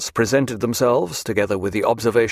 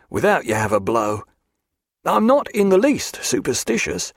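higher, lilting seaman's voice; earnest, ominous sea-lore in a raised pitch; superstitious old salt; 6 semitones higher than usual. A narrator's reading. Seaman warns his captain of a blow, superstitious old salt.

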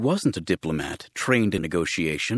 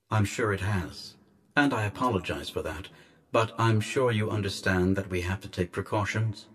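softer, measured official over a speaker; disembodied guardian voice; 2 semitones lower, 5 dB quieter than usual. Acted. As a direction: apologise quietly, with cool courtesy.

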